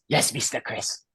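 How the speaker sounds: softly voiced, deferential reply; imitated John Lee. John Lee answers the customer's question, lightly imitated, soft. Retold reply of Haitian waiter.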